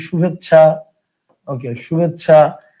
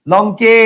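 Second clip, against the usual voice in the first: লঙ্কে with the final e loud and held; stress on final vowel; 2 semitones higher, 5 dB louder, much slower than usual. Shown as a demonstration. Teacher showing where the e-kar sits, লঙ্কে with its last vowel stressed. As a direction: push and stretch the e ending of লঙ্কে. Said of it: "একার আছে".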